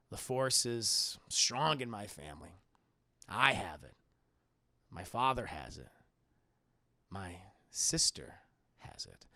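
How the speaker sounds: very low, hushed gravity with long pauses; mock-solemn revelation. Luke revealing the family secret to Leia, solemn. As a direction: intone it gravely, pause by pause.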